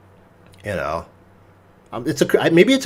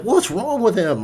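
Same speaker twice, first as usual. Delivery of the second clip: higher, slow and bewildered; a customer stunned by a man's outburst, bewildered; startled bystander; 8 semitones higher than usual. Retold or imitated. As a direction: ask it like you can't believe what you just saw.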